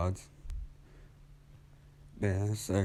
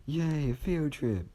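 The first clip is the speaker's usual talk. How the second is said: higher, slow mock cheer; 9 semitones higher, slower than usual. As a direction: cheer it in a sing-song. Class cheers.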